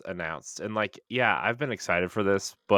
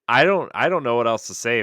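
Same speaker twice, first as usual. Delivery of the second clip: louder, higher, overwhelmed with excitement; real excitement; 5 semitones higher, 9 dB louder than usual. Real, not acted. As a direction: burst out, lost for words with excitement. Gushing over an incredible trailer.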